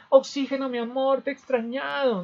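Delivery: lovestruck greeting. Acted. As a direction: gush over your returning love. Hemoglobin greets her love.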